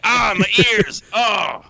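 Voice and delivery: high, loud yelp through laughter; pained outcry. Real, not acted. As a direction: cry out as if the noise hurts your ears. Host's ears hurt by loud mic.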